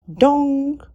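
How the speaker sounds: loud high bong of a clock; booming chime of the clock. A narrator's reading. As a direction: ring it out like a big clock. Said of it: "rang the clock".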